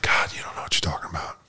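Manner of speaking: low, whispered scoff; half-whispered eye-roll of a retort; hushed put-down. Retold or imitated. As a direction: mutter it like an exasperated counselor. Counselor dismissing him, acted quote.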